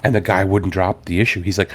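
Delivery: usual speaking voice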